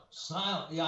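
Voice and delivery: ordinary delivery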